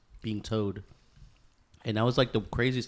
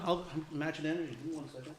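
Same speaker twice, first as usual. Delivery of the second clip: normal talking voice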